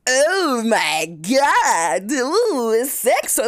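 high, loud, slow scandalized gasp; shocked prude. Retold or imitated. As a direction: clutch your pearls at it. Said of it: "so that it's not this huge, like".

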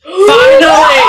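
joyful yell, high and loud; excited shout. Real, not acted. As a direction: shout it with delight. Long-awaited date agreed, shout.

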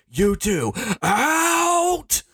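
high shouted command with a breaking yell; Gary Oldman line. Retold or imitated. Host doing the president ordering the gunmen out, hammed-up shout. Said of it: "That's a Gary Oldman line".

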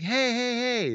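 high calls to get a driver's attention; calling out. Retold or imitated. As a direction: shout over to the next car, high. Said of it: "So I'll be like".

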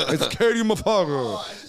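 light swaggering imitation; repeating Bernie Mac's line, lighter imitation. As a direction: toss off the catchphrase again. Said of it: "I fucking love Bernie Mac".

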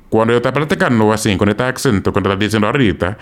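fast, native-sounding Dominican Spanish; Dominican accent. Shown as a demonstration. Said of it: "when I talk like that".

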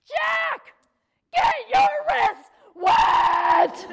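a mom screaming at her small son; enraged shout. Retold or imitated. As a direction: bellow it from the gut like a furious mom. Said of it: "I had to bend over to get my voice out".

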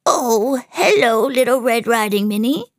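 high, wobbly put-on old lady voice; squeaky fake grandmother. Disguised villain greets Minnie as her grandmother, squeaky. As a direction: squeak it out, posing as granny. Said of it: "he squeaked".

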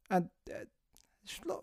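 whispered aside about the lovely nurse. Real, not acted. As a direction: drop to a secretive whisper.

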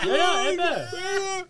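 high drawn-out laughing yes. Real, not acted. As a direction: laugh through the words. Laughing at the joke.

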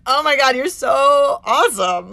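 delighted, over-the-top praise; gushing admiration. Retold or imitated. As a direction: gush at a friend, leaning hard on "so". Acted gushing praise to friend.

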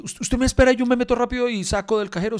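high, loud, hurried pleading; urgent plea. Retold or imitated. Le ruega al taxista asustado. (suplicando al taxista que espere, agudo y urgente)

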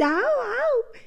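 high yapping woof-woof imitation; playful dog bark. Shown as a demonstration. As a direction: bark like a small dog for the kids. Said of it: "تعملي دراما للتنين". (نباح كلب مرح بصوت عالٍ)

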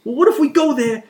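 high, loud goofy Jersey guy; mock basement guy raising a doubt. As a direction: worry about the monkeys like a dim tough guy. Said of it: "it's like".

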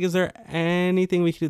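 high, hopeful asking voice; imitating a careful plea for help; retold tentative request.

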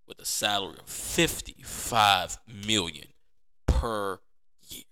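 drawn-out, weighty delivery of the figures; slow emphatic reveal. Real, not acted. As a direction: let each number land slowly. Announcing the contract numbers.

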